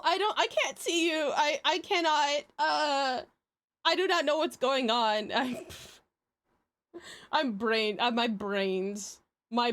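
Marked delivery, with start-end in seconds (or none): none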